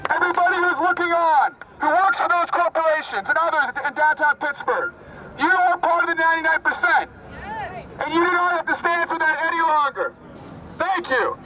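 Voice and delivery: rally speech declamation. Real, not acted. Activist addresses an Occupy rally, declaiming phrase by phrase. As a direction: declaim in short phrases with long pauses.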